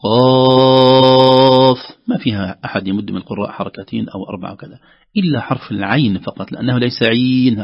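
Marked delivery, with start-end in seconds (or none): 0.0-1.8 سين held long, very slow, half sung; six-count elongation. Shown as a demonstration. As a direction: stretch the vowel of سين over six beats. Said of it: "تمد مدا لازما، ست حركات".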